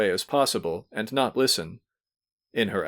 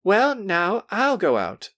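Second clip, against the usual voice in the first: put-on higher, slower young girl; Nadia's voice; 10 semitones higher, 3 dB louder, slower than usual. A narrator's reading. Nadia volunteering to guess, higher girlish voice. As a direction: offer it like a spirited girl. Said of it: "said Nadia".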